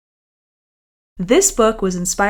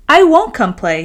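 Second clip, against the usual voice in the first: refusing; 6 semitones higher, 7 dB louder than usual. Acted. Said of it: "he said".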